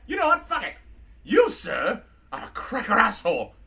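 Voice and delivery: put-on drunken British robot working up to outrage; drunk C-3PO. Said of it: "He's all like".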